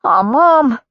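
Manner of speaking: high, loud, hurting cry of Mom; devastated. A narrator's reading. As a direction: let it break out of him. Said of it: "he said, devastated".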